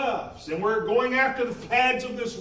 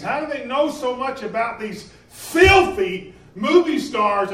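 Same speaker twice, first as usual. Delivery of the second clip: loud, emphatic railing, building to a peak; indignant preaching; 3 semitones higher, 6 dB louder than usual. Real, not acted. Preacher challenging message churches about filthy stars, real indignant force. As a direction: hammer it out, louder toward the last word.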